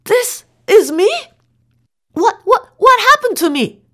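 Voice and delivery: astonished. A narrator's reading. Astonished at new face.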